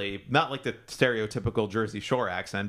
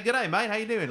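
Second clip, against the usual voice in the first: mock Australian; 11 semitones higher than usual. Teasing co-host about moving to Australia, mock Australian accent. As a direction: put on a cheery high Aussie accent. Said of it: "if you moved to Australia".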